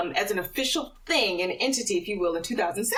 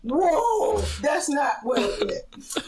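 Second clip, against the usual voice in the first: startled whoa; 4 semitones higher, slower than usual. Retold or imitated.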